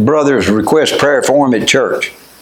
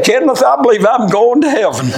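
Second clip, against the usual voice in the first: put-on joyful old man; 10 semitones higher than usual. Retelling dying man's joyful words.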